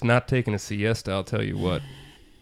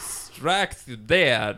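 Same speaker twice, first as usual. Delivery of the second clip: slow dramatic echo; much slower than usual. Pretend.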